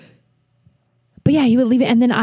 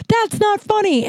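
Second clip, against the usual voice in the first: indignant protest; 12 semitones higher than usual. Retold or imitated. Retold reply to laughing Kyle.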